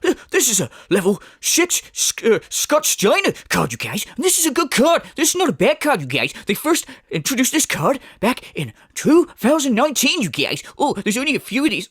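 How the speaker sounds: mock the obsessive expert, lightly voiced; nerdy know-it-all parody. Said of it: "The amount of knowledge he has".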